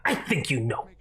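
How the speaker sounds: hushed, low menacing impression; quiet mock-villain threat; angry Q. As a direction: drop it to a knowing, sinister near-whisper. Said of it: "Q is angry and he talks like this".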